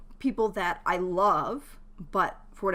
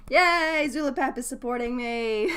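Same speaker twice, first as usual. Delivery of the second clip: high, loud yay settling into delight; pleased, sing-out celebration; happy cheer; 5 semitones higher, 5 dB louder than usual. Real, not acted. Streamer cheers a supporter's alert.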